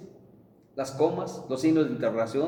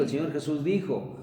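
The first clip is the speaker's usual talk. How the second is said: ordinary talk, nothing marked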